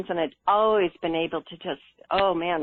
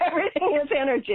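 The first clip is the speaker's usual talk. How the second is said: quick, higher-pitched mock protest; mock arguing; 6 semitones higher, much faster than usual. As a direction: rattle off a playful objection. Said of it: "habit always of arguing with my committees".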